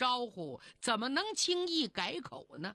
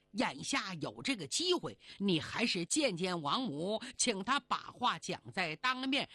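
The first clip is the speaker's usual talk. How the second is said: cautioning Wu Gang; 2 semitones lower than usual. Acted. Wu Gang warns the fairy not to be careless, earnest caution. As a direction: counsel her earnestly, pitched down at first.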